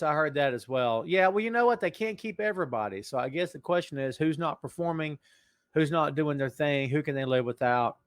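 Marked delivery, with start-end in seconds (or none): none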